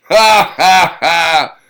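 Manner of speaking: loud booming ha ha ha; big loud laughing roar. A narrator's reading. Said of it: "Thor roared his famous laugh".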